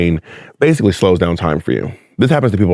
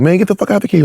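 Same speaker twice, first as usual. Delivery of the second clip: higher, imitated angry uncle yelling; retelling an uncle's cursing rant, mock yell; 10 semitones higher, much faster than usual. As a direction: bark it like a fed-up uncle shooing someone out. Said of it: "like, cursing at him".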